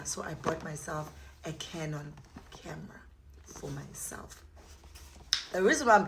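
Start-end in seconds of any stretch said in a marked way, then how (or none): 0.0-4.4 hushed, awed whisper. Real, not acted. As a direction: breathe it like a secret. Whispering the joyful news.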